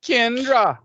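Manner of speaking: loud, high, hammed-up Jamaican accent; bad Jamaican accent. Host mocking Kendra's introduction, bad Jamaican accent. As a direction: botch a Jamaican accent at full volume. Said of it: "You can do a bad accent".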